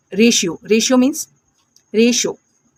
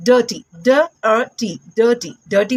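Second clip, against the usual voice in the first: slow spelled-out word; much slower than usual. Shown as a demonstration. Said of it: "okay, now".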